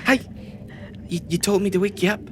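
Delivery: high quick tiny pixie voice; fast little fairy voice. Acted. Penny the pixie speaks.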